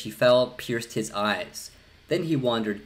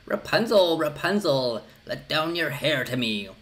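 calling up; 4 semitones higher than usual. A narrator's reading. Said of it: "he heard how she cried".

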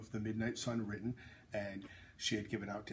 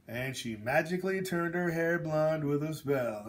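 slower, higher, louder mock storyteller; storybook narrator voice; 5 semitones higher, 6 dB louder, slower than usual. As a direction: read it like a fairy tale. Mock fantasy narration of the fix.

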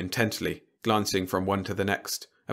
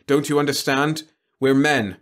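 louder, higher, insistent protest; sharp; 7 semitones higher, 7 dB louder than usual. A narrator's reading. Franks pleads humanity to the leadys, sharply. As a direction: drive the point home sharply. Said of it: "Franks said sharply".

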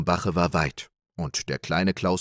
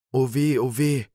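higher, sighing little voice from inside the sack; sighing lament; 6 semitones higher, 4 dB louder than usual. Acted. Little Klaus trapped in the sack, sighing woefully. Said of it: "seufzte der kleine Klaus".

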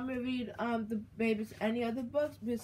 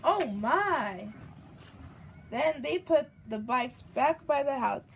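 lively, louder storybook read-aloud; reading to a child; 5 dB louder than usual. A narrator's reading. Mother reading a picture book to her baby, lively storybook voice. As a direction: read it out brightly for the little one.

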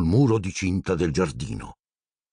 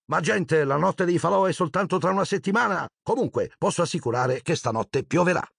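higher, breezy TV forecaster; jovial weatherman; 8 semitones higher than usual. Acted. Weatherman joking with the newsreader, breezy higher voice. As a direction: chat cheerfully like a weatherman.

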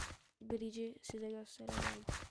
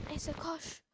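usual speaking voice

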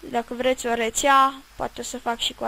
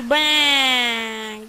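crash noise; 8 dB louder than usual. Pretend. Crash in driving game.

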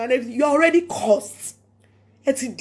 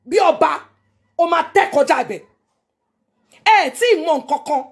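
angry tirade; 3 semitones higher than usual. Real, not acted. Confronting the king who threatened her, furious shouting. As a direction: rage at him, voice climbing.

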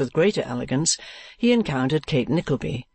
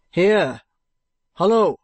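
loud cry, higher; calling out; 6 semitones higher, 5 dB louder, slower than usual. A narrator's reading. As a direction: call out sharply. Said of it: "cried Ralph".

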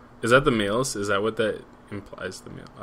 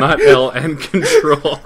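louder and slower, breaking into laughter; laughing; 4 semitones higher, 14 dB louder, slower than usual. Real, not acted. Teasing her friend about the keys, laughing hard. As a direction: correct him while cracking up. Correcting him while laughing.

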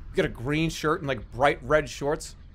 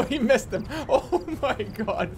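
ordinary delivery

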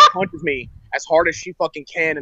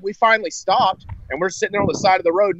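ordinary talk

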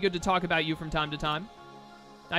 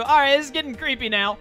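amused; 7 semitones higher, 6 dB louder than usual. Real, not acted. Reacting to petting scene.

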